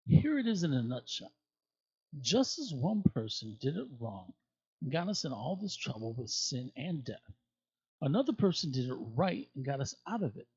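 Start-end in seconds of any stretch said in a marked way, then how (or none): none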